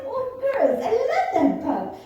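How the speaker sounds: high, loud, laughing gush; gushing over the pup. Retold or imitated. Telling the girls she loves the pup, a high laughing gush. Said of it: "I said".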